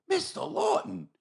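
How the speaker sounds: high, slow, gushing judge greeting; imitating the judge. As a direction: fawn over him like a delighted fan. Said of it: "The judge goes".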